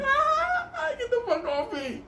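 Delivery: high laughing mock scream; hammed-up panicked yell at the dog; [name] screaming. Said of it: "[name] is screaming".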